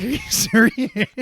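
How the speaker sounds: injured husband can't believe her, high loud disbelief; incredulous outrage. Retold or imitated. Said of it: "And I'm like".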